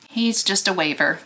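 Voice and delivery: normal talking voice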